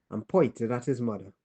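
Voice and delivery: ordinary delivery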